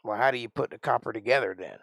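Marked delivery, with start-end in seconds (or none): none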